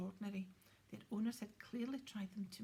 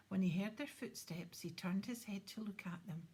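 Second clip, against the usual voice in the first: usual speaking voice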